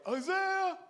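high call up the hill; shouted name. Retold or imitated. Dad calling his son again from below, a high shout.

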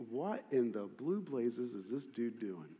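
a bewildered aside to yourself; baffled mutter. Retold or imitated. Onlooker reacting to Jesus, baffled low mutter. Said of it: "most of our responses would have been".

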